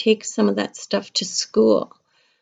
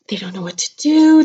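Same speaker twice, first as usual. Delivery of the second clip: whisper; 6 dB louder than usual. Acted. Confiding to kids about grown-ups.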